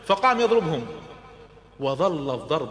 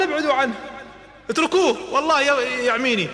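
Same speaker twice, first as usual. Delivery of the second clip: high, loud pleading yell; a terrified scream for help; panicked cry; 11 semitones higher, 4 dB louder than usual. Acted. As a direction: beg them to back off before he's blinded. Utba screams in pain.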